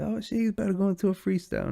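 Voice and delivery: high, rushed mock excitement; hyped-up listener. Imagining listeners expecting a freestyle, mock hyped.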